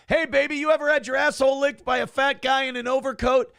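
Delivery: high, hollered come-on; yelled catcall. Acted. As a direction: yell it high across the street. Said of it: "It's a weird thing to yell".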